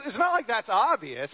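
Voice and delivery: normal talking voice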